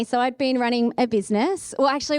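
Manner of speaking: ordinary talk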